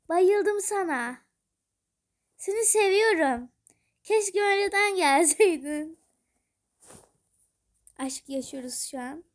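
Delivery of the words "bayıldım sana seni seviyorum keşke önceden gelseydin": gushing. Real, not acted. Gushing over new game item.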